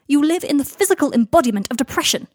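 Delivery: loud, high, furious complaint; exasperated outburst. Acted. Draco losing patience with Harry's house, exasperated outburst. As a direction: let the frustration burst out.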